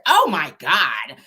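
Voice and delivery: slow, stretched Valley Girl imitation; Valley Girl accent. [name] reacting to being yelled at, mock Valley Girl drawl. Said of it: "this real Valley Girl accent".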